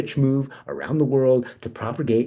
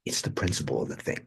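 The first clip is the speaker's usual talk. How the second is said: whispered low, keeping it from the conductor; hushed whisper; 7 semitones lower, 10 dB quieter than usual. Acted. Sneaking on the train, Benji whispers to David. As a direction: breathe it under your voice.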